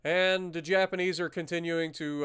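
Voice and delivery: ordinary talk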